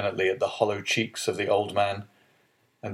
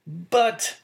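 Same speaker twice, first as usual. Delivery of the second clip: high, sharp protest; cried out; 13 semitones higher, 3 dB louder than usual. A narrator's reading. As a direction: blurt it out in protest. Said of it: "cried Dante".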